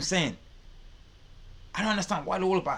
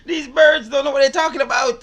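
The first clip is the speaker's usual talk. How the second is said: loud, high, incredulous cackling shout; laughing mockery; 11 semitones higher, 12 dB louder than usual. Real, not acted. Host ridiculing the people in the clip, loud amused mockery. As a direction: crow it out through your laughter.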